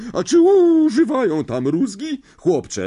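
put-on high, loud, overbearing old aunt; a big domineering aunt who loves her dog; bossy aunt. A narrator's reading. Marge questions Harry. (władcza ciotka, wysoki głos)

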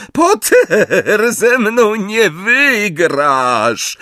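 high, drawn-out yell; high-pitched, slow defiant cry; shrieked. A narrator's reading. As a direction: scream it as a taunt. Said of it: "krzyknęła".

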